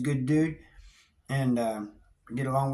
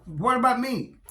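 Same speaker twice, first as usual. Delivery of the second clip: high, loud pleading question; begging plea; 10 semitones higher, 5 dB louder than usual. Retold or imitated. Left behind in the cell, begging the guards loudly. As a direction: beg not to be forgotten. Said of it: "I'm begging on".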